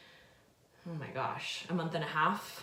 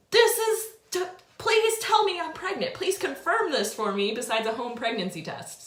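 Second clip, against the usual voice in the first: desperate plea; 10 semitones higher, 8 dB louder than usual. Retold or imitated. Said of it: "I'm like".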